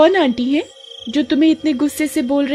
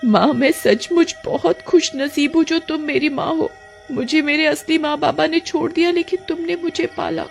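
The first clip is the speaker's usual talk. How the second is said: higher, soft and fading, close to tears; grateful daughter; 7 semitones higher, 3 dB quieter than usual. Acted. Roopa thanking her adoptive mother, soft and tearful.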